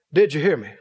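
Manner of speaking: ordinary talk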